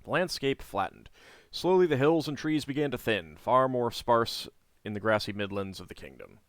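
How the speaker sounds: steady storybook read-aloud; story narration. A narrator's reading. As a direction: read the quest text like a novel. Reading the game's story text.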